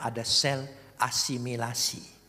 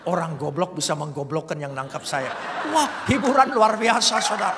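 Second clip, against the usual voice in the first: much louder, laughing exclamation; 6 semitones higher, 10 dB louder than usual. Real, not acted. Preacher telling the congregation how amused he was, laughing. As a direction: laugh it out with delight.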